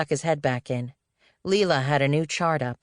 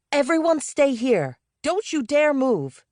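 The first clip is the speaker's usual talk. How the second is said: high, sharp order, the second line slow; command; 11 semitones higher, slower than usual. A narrator's reading. Leela orders the team not to move, high and commanding. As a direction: lay down the law, high-pitched. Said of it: "he heard Leela command".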